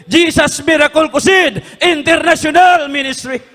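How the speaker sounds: ringing proclamation; proclaimed shout. Retold or imitated. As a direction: call the name out high and loud. Vision voice proclaiming the ministry name.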